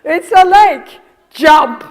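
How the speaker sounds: loud, high barked order; mock-shout it as a blunt command; brusque wrong way. Bad therapist as mother ordering toddler to jump, loud. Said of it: "is just like that".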